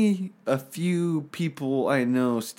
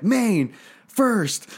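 cocky soldier; 9 semitones higher than usual. Retold or imitated. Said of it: "they're just like".

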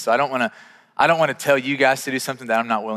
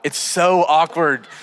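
cringing awkwardness; 2 semitones higher, 5 dB louder, much slower than usual. Retold or imitated. Acting out his awkward approach.

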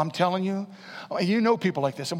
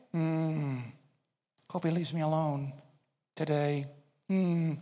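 timid thought; 3 semitones lower, 7 dB quieter, slower than usual. Shown as a demonstration. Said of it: "Or you just think".

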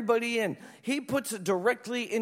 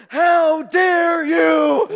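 slow, drawn-out loud angry shout; furious scolding; 8 semitones higher, 13 dB louder, much slower than usual. Retold or imitated. Mother rounds on her son after the scare, slow furious shout. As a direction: hammer each word like a furious mother. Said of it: "Amy just lit into everybody".

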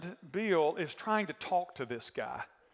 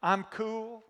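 usual speaking voice

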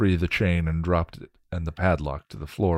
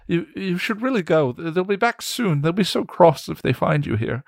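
put-on much higher, stammering scared young man; frightened William; 11 semitones higher, 5 dB louder than usual. William begs Willow to leave before they come, frightened and stammering. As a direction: plead nervously, tripping over the words.

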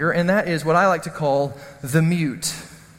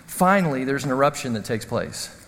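ordinary delivery

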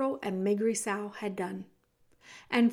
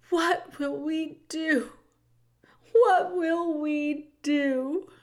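high, drawn-out storybook wail; 5 semitones higher, 3 dB louder, much slower than usual. A narrator's reading. Cook despairing in Louise's arms, slow high wail. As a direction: draw each word out slowly in a high lament. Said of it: "wailed the cook".

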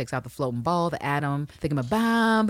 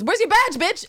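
high, louder bossy demand; mock angry police chief; 5 dB louder than usual. Chief of police scolds the officer, mock angry. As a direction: bark it like a fed-up boss.

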